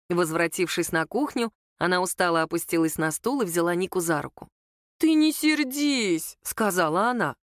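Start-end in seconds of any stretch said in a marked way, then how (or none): 5.0-6.4 high, very slow coaxing; placating mother-in-law. A narrator's reading. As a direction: soothe her with drawn-out words. Mother-in-law placating the young woman.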